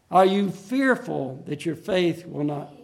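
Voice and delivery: normal talking voice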